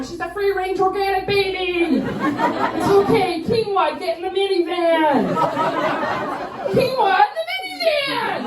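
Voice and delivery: high, loud hippie-mom voice with laughter. Retold or imitated. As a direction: chirp it like a proud crunchy mom. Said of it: "I'm like".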